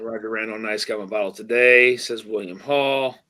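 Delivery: usual speaking voice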